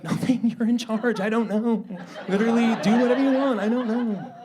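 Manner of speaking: high, backing-down mock whine; cowed little brother. Democrats as little brother caving to big brother, mock meek. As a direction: give in at once, pitched right up. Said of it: "And they're like".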